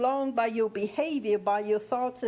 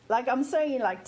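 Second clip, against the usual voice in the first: ordinary delivery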